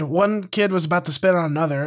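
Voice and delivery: usual speaking voice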